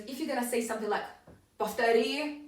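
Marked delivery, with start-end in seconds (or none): 1.6-2.4 a bare, slightly unfriendly "повтори"; unfriendly demand. Shown as a demonstration. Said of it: "it's gonna sound a little bit unfriendly".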